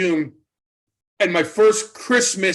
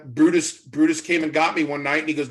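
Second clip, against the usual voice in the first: normal talking voice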